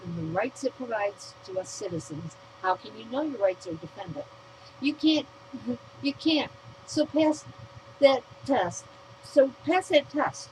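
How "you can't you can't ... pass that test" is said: loud, slow, punching each word; So pass that test shouted slowly; angry demand. Real, not acted. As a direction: hammer the demand home.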